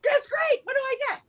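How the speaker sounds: very high, excited acted voice; eager winner. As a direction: play the thrilled second winner. Second winner reacting eagerly.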